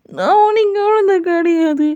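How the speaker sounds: very high pouting protest; indignant childlike. Acted. Jungkook protesting to the mother that he is no baby, very high pouting voice. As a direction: insist like a sulky kid.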